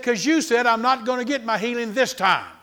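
high, loud acted retort to the devil; retold defiant comeback. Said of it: "He said".

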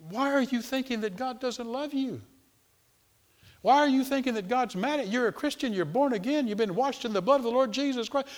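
high-pitched, insistent reasoning without added volume; incredulous questioning. Acted. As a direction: plead with them to see sense.

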